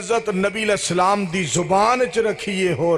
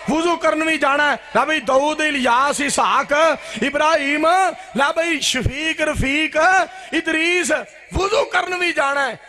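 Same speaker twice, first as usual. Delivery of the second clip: high, mimicking roll call of names; mock calling out; 9 semitones higher than usual. Preacher mimicking objections to hiding during ablution, high mock calling out. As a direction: call each name out high, then protest.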